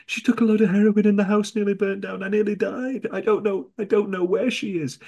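high, hammed-up distress; mock panicked lie. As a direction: pile up fake tragedies in a high voice.

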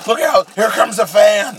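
high, loud mock warning cry; mock alarm. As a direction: yell it like a hammy commentator. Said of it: "That was".

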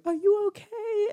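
put-on very high, concerned girl's voice; soaring high-pitched "are you okay"; jogging girl asking. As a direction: squeak it out like a worried passer-by. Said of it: "the girl that's jogging behind me is going".

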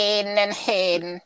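put-on high, slow Jamaican accent calling names; Jamaican teacher accent. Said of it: "It'll be like".

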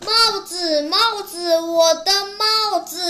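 high, loud mock wail over a lost thing; mildly hammed-up anxious cry. A narrator's reading. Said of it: "胖胖急得哭起来".

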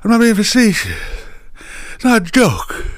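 high put-on imitation of an old politician; Joe Biden impression. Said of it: "just not to quote Joe Biden".

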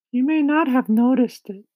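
high-pitched, loud character voice; kindly ladybug. A narrator's reading. Ladybug telling James of their departure, high, loud voice. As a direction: speak up high as an eager ladybug.

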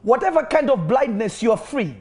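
high, loud declarations of healing; full-force preaching. Acted. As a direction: call out each illness and break it.

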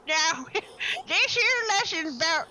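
lightly voiced toothless old-timer; toothless Great Depression voice. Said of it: "sorry, my dentures fell out".

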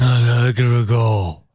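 slow, drawn-out mock dodge; evasive politician. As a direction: stall like a cornered senator. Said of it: "That's a famous political phrase".

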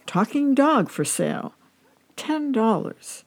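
sign read out. A narrator's reading.

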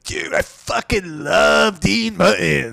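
slow, drawn-out stoner dude voice, lightly done; imitating the passenger. Comedian mimicking an odd passenger gushing about [name], slow and drawn out. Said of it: "That's how he sounded".

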